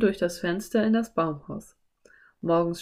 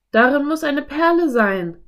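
loud, raised-pitch exclamation; calling out; 8 semitones higher, 7 dB louder than usual. A narrator's reading. Philipp realises there must be a pearl, loud exclamation. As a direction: call it out high and loud. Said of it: "rief Philipp".

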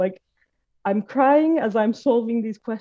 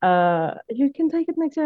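much higher, soothing cleaning-lady voice; 6 semitones higher than usual. Retold or imitated. Cleaning lady comforting the sobbing student, high and soothing. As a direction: voice a gentle older woman consoling a crying student. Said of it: "she was like".

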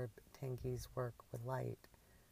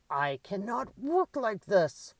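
loud, high, slow diva outrage; imperious; 7 semitones higher, 10 dB louder, slower than usual. A narrator's reading. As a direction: proclaim it with haughty, stretched-out indignation. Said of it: "the crow head repeated imperiously".

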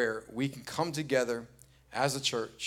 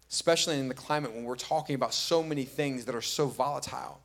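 ordinary talk

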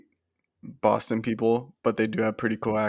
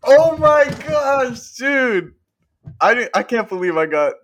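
excited disbelief; 11 semitones higher, 7 dB louder than usual. Real, not acted. Guest realizing the trivia guess was right, stretched excited disbelief. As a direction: draw it out in happy shock.

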